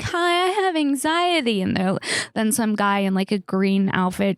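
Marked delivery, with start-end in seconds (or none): 0.0-1.6 high, put-on nervous little voice; hammed-up timid patient asking for help; mock anxious patient. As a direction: play up the anxiety to get the card. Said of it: "being like".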